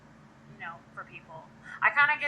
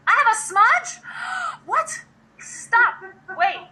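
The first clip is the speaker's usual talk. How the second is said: high, loud startled outburst; shocked disbelief; 13 semitones higher, 13 dB louder, much slower than usual. Real, not acted. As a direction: gasp and blurt it out, appalled. Startled to hear of lipstick smudge.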